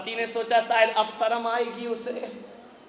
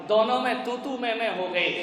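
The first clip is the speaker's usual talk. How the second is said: ordinary delivery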